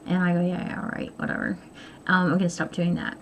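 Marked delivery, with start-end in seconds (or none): 0.4-1.6 dismissive brush-off. Retold or imitated. Brushing off husband's compliment.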